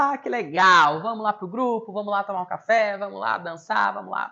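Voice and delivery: high, loud, enthusiastic invitation; rally someone to join the group, upbeat; bubbly coaxing, starting loud and easing off; imitated cheerful encouragement. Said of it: "esperava ela acabar e falava".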